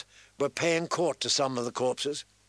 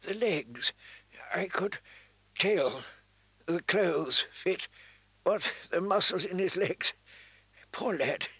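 halting, higher-pitched dying man; weak, dying voice; 4 semitones higher than usual. A narrator's reading. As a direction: break it up between failing breaths. Dying maester, coughing blood.

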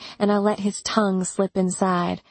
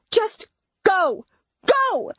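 yelled; 13 semitones higher, slower than usual. Acted. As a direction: scream at him to go, in panic. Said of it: "I yelled".

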